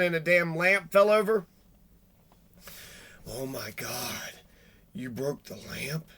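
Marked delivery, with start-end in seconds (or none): 3.3-6.1 low, slow, much softer sneering mimicry; mocking the stepmother. Host mocking how the stepmother scolds the child. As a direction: drag it out low and quiet like a cold scolding parent. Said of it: "talking to Gannon like an asshole".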